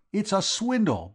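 high, loud cry of outrage; outraged protest. Acted. Vowles objecting to George's news, outraged. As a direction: protest it as a cheated man.

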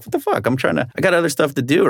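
high, incredulous what the fuck, then rushed complaint; exasperated disbelief. Retold or imitated. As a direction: squeak it out, then vent fast. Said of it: "you know, like".

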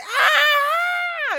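high, loud mock shriek through laughter; hammed-up yelp of pain, half laughing; imitated scream. Retelling the boy's foot caught in the trap, laughing mock scream. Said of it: "and then it's like".

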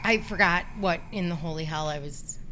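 normal talking voice

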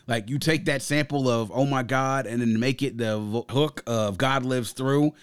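normal talking voice

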